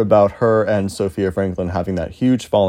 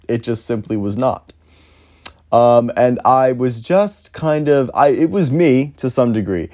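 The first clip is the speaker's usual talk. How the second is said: ordinary talk, nothing marked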